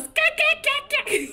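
put-on very high, loud toddler gah; imitating son babbling at the booth; excited toddler. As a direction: squeal like a thrilled baby. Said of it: "he just stands there and he goes".

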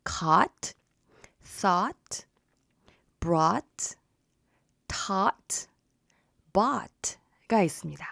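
caught, thought, brought with open ah; ah vowel in caught and bought; saying ahh. Shown as a demonstration. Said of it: "똑같은 발음 다시 한번 보면".

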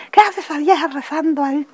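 high-pitched imitated outburst; retold scolding. About an old woman praying, imitated high scolding. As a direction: raise the voice high, quoting a scolder.

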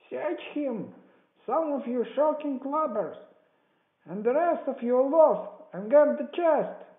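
high, building shouted orders; cursing cry. A narrator's reading. Blind beggar swearing at his men for delay, loud shout. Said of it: "he cried".

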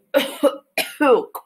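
small cough into the elbow; demo cough into sleeve. Shown as a demonstration. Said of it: "And you go".

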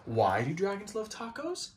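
ordinary delivery

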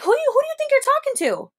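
high, fast, incredulous challenge; high mock outrage, rushed; indignant. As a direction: squeal it out in disbelief. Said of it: "I'm like".

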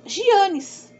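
high, loud "Gianes", zhee-AH-nees; mispronounced jeans. Retold or imitated. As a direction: announce the slip "Gianes" with mock emphasis. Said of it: "Ela colocou no thumbnail".

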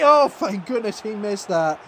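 ordinary talk, nothing marked

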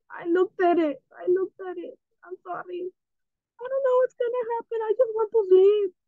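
high, fast frantic apology; panicked. Real, not acted. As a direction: gabble it out in rising panic. Enderman panic continues.

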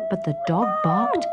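ordinary delivery, nothing marked

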